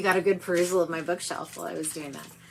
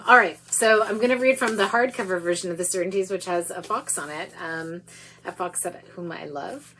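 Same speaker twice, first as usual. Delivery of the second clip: ordinary delivery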